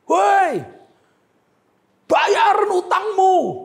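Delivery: furious creditor hollering his demand; angry shout. Retold or imitated. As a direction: bellow at a debtor across the hall. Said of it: "dia berteriak cukup kencang".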